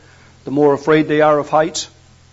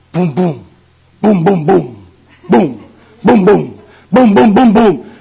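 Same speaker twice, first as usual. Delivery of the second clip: imitated booming bass; slower than usual. Preacher mimicking a passing car's bass turned up high, imitated booming. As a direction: mimic car-stereo bass thuds, rising as it nears. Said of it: "somebody's got their bass turned right up high".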